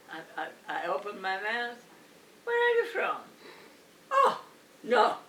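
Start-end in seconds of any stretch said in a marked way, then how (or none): 2.4-3.2 high, loud "Where are you from?"; high mock customer. Customer hears her accent, asks loudly and high. 4.1-5.2 loud, high "Oh, no"; mock dismay. She is caught out as foreign, loud dismayed cry. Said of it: "her dismayed reaction".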